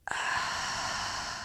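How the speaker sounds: slow, airy sigh through the mouth; long sighing exhale. Shown as a demonstration. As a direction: let the whole breath go audibly.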